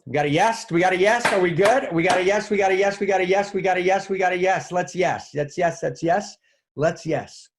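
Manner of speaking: high, loud ecstatic chant of yes; chanting for the audience's yeses; excited cheering. Real, not acted. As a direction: cheer each yes like a win.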